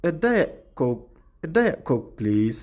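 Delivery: "እዳ የ" stopped short, no t before "ኮክ"; cut-off Diet. Shown as a demonstration. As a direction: break off "የ" sharply, then "ኮክ". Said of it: "ይ ቁርፅ ተብላ".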